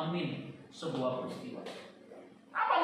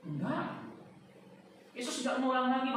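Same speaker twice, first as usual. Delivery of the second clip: ordinary delivery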